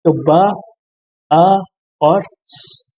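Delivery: ब, अ, स as three separate sounds with pauses; sounded out separately. Shown as a demonstration. Teacher splitting bus into its sounds, sounded out separately. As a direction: break it into ब ... अ ... स, each sound alone. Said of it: "sound को हम तोड़ते हैं हिस्सों में".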